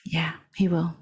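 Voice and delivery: breathy whispered aside. Acted. Player whispers agreement to Vasilka so her father can't hear, hushed whisper. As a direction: keep it secret from the father, just whisper it.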